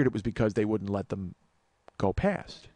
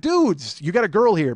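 higher, louder call over the music; calling out to the dudes; 5 semitones higher, 7 dB louder, slower than usual. Retold or imitated. Telling the boyfriends to mind their girlfriends, loud call. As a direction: get their attention over the band. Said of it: "I'm like".

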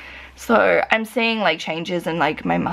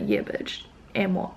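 whispered cheeky dog talking back; sassy dog voice; 8 dB quieter than usual. Retold or imitated. Dog answering its scolding owner, in a sassy whisper. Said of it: "he's just like".

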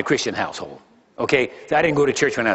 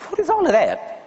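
high, puzzled "What is all of that?"; mock bewildered newcomer; incredulous question; 12 semitones higher than usual. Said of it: "I said".

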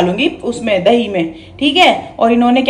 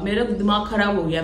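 normal talking voice

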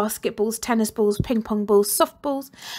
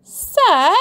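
much higher, loud call; 9 semitones higher, 9 dB louder than usual. Acted. Girl calling to teacher from doorway, loud high call. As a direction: call across the corridor. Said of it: "called out Rebel from a doorway".

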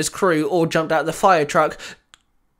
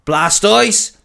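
louder commanding call; ordering; 2 semitones higher, 7 dB louder, slower than usual. A narrator's reading. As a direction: bark the attack at the Pokemon. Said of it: "Baz ordered".

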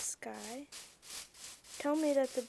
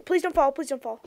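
scared pleading; 10 dB louder, faster than usual. Real, not acted. Gamer on a scary bridge, begging her unicorn loudly.

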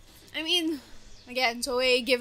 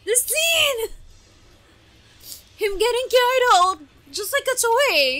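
higher, louder eager gushing; excited; 7 semitones higher, 5 dB louder than usual. Real, not acted. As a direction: rave about it to the viewers. Hyped by the fight.

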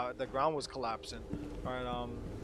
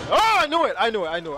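high scream falling into loud repeats; loud, panicked "called it"; alarmed yell; 7 semitones higher, 14 dB louder than usual. Real, not acted. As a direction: yell it as the floor gives way. Floor breaks as predicted.

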